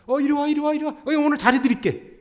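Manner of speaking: high, loud calling out to customers; a shopkeeper mother luring passersby in; market vendor calling. Retold or imitated. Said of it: "그분 어머니가 장사하셨거든요". (높고 크게 손님 부르는 장사꾼)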